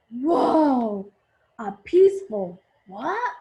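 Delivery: loud startled exclamation, rising at the end; surprised. Acted. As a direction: react surprised and confused. Family member hears Mary faintly.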